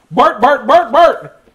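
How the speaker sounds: high, loud "bark" four times over; repeated barks. A narrator's reading. Bartholomew finally barks like a real dog. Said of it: "Say it again three times".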